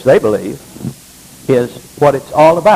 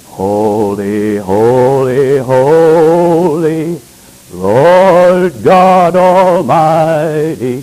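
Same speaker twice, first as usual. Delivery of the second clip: mock TV worship; much slower than usual. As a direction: parody a televangelist's ecstatic praise. Mimics Pentecostal TV worship.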